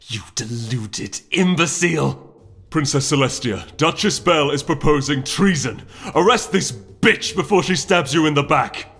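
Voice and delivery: rising, heated insult; angry accusation. Acted. Duke insulting the duchess before the princess, heated. Withering glare.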